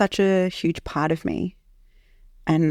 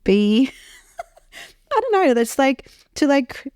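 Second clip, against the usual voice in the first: laughing; 8 semitones higher than usual. Real, not acted. Laughs, searching for words.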